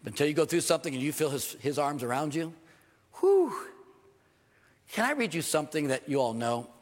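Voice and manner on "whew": high, loud awed whew; exhaled whew. Real, not acted. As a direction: let out a big amazed whew. Overwhelmed by God's embrace.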